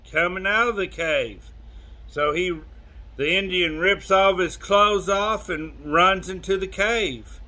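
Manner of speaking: plain reading voice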